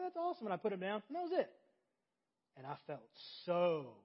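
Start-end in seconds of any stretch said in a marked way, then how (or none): 0.0-0.5 high-pitched little-kid glee, imitated; delighted schoolkid. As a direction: marvel like a small boy. Acting out his delighted childhood self.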